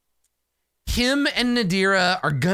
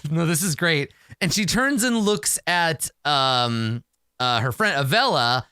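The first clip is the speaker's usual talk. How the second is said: ordinary talk, nothing marked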